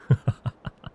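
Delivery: short staccato villain laugh; self-pleased theatrical laugh; vampire chuckle. Acted. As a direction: cackle at your own dark pun. Vampire laughs at own pun.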